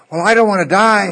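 high, loud, whiny plea not to die; mock frightened patient. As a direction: ham up a patient who just won't let go. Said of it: "they're freaking out".

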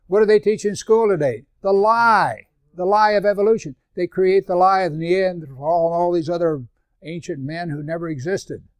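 loud, high preaching; preaching at full force. Acted. Pastor preaching against evolution, loud and high. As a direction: rail against the lie.

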